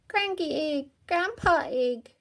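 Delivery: high piglet voice calling for grandpa; toddler Peppa crying out. A narrator's reading. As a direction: call out like an excited toddler. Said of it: "cried Peppa".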